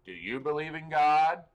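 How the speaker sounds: hammed-up stranger blurting it in your face. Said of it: "get in somebody's face in public and go".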